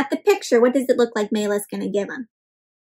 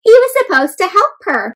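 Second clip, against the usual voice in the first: higher and louder, insistent; indignant emphasis; 7 semitones higher, 6 dB louder than usual. Real, not acted. Teacher to children, indignant. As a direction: stress the broken deal.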